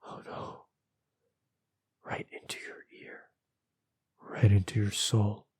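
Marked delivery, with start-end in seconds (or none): 0.0-0.6 tiny whispered aside; hushed dread. Real, not acted. Reader reacting to something whispering in the story, hushed dread. 2.0-3.2 very soft, creepy whisper. A narrator's reading. Narrator describing a watcher at the listener's ear, whispered. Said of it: "if it had whispered".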